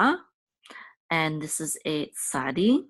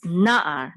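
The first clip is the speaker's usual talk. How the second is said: na'ar with NA punched long and loud, then a separate ar; stress on first syllable; 7 dB louder than usual. Shown as a demonstration. Teacher showing learners where the accent sits in na'ar, stress on NA. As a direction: hit the NA of na'ar, let the ar drop. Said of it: "the accent is on this first".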